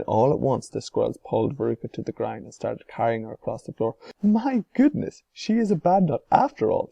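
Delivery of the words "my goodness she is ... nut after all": mock surprise. A narrator's reading.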